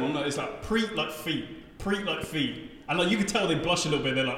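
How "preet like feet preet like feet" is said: slow, rhyming "Preet" with "feet"; "Preet like feet" spelled out slowly, as if teaching; name pronunciation demo. Shown as a demonstration. Comic teaching crowds to say his name, slow and careful. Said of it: "I always say to them".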